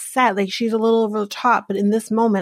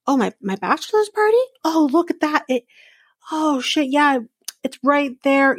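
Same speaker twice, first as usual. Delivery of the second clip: high, fast, hammed-up discovery; mock surprise; 5 semitones higher than usual. Husband pretending to find the party in his calendar, hammed up. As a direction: fake being amazed.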